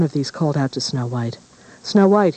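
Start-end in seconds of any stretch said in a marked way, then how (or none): 1.8-2.4 loud, high barked command; stern order. A narrator's reading. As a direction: call her name like an order. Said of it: "he ordered".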